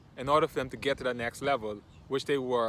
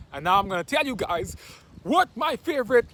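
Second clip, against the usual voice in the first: louder, higher announcement of a reveal; excited build-up; 9 semitones higher, 7 dB louder than usual. Real, not acted. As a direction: build suspense for the big pick.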